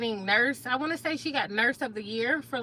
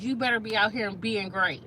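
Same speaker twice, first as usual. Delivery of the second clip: normal talking voice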